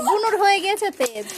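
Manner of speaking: ordinary delivery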